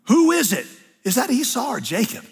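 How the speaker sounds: put-on old Isaac. Blind old Isaac questioning.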